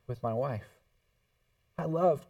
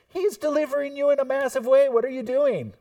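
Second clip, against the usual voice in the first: ordinary delivery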